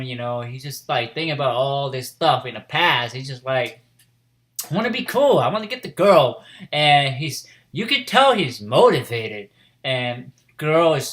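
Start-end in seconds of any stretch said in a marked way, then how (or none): 4.7-6.4 high, loud, eager outburst; retold eager wish. Boy pondering what to do, high eager declaration. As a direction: voice his longing with a big push. Retold character voicing his wishes.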